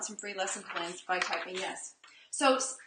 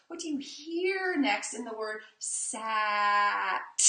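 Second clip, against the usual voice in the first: slow emphasis; much slower than usual. Shown as a demonstration. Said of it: "we're always emphasizing hearing first".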